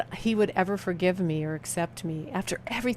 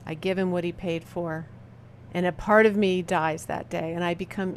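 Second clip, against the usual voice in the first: ordinary talk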